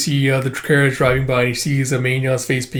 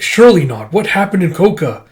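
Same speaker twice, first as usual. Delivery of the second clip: shocked disbelief; 6 semitones higher, 6 dB louder than usual. Acted. General shocked by news.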